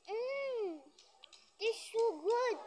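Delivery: high, drawn-out yummy; delighted mmm. Real, not acted. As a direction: savor it like a treat. Tasting candy for the camera.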